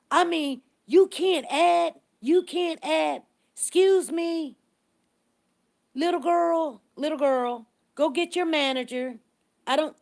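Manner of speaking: high, loud mock tirade; irate customer imitation.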